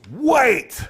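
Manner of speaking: high, loud chieftain's yell. Acted. Chieftain joining the retinue, yelling. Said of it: "and yells".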